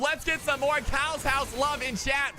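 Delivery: hyped esports caster call; live play-by-play. Acted. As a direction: call the fight fast and excited.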